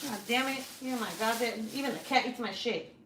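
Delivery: real annoyance; exasperated grumbling at cat. Real, not acted. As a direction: complain crossly about the thieving cat.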